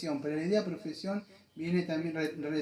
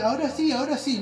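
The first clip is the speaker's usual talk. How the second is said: mock permissive voice; 8 semitones higher, 7 dB louder, faster than usual. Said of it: "el Señor no va a decir".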